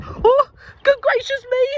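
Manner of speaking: very high, loud startled cry; hammed-up storybook shock; shocked exclamation. A narrator's reading. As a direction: gasp in horror at the water.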